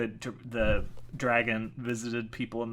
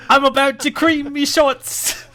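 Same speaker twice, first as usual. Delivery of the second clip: mock British accent; 13 semitones higher, 15 dB louder than usual. Teasing co-host's excitement, loud hammed-up British accent. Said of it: "sorry for any British people".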